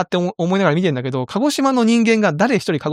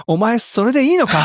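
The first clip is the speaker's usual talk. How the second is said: mock earnest movie hero; 8 semitones higher than usual. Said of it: "そういうキャラでこう出てきてね".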